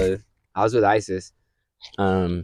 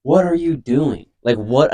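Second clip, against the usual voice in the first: incredulous; 6 semitones higher, 4 dB louder, slower than usual. Retold or imitated. His own retold reply to bully.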